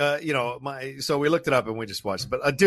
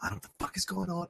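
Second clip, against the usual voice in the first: panicked whisper; 2 semitones higher, 13 dB quieter, much faster than usual. Retold or imitated. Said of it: "I'm like".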